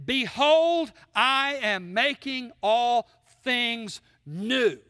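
slow, weighty declaration from the throne; stately, very slow pronouncement; solemn divine proclamation. Acted. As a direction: proclaim it word by word, drawn right out. Voicing God on the throne.

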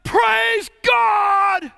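loud, high cry of praise; drawn-out acted hallelujah shout; joyful shout. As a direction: shout it out to a crowd. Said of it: "somebody shouted".

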